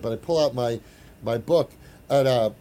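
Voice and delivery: ordinary talk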